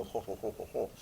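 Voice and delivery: low, soft mock English chortle; English accent. Shown as a demonstration. Teacher contrasting England with America, mock English accent. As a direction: imitate posh-sounding English noises, low and soft. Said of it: "but in England".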